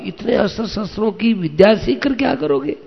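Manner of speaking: high, quick, amused question; teasing question. A narrator's reading. Indra tests Arjun's wish for weapons, teasing question.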